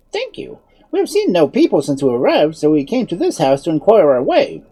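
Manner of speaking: high-pitched, courteous wizard; polite wizard. A narrator's reading. Wizard addressing his unseen hosts, courteous.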